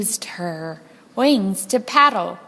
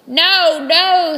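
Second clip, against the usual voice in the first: impatient ringmaster; 7 semitones higher, 7 dB louder than usual. Acted. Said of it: "said the ringmaster".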